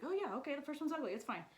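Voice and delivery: lightly voiced nervous kids; high, relieved kid voice. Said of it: "And so, like".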